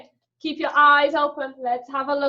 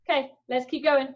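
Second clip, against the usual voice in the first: usual speaking voice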